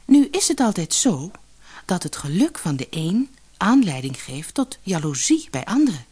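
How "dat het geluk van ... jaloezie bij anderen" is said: plain reading voice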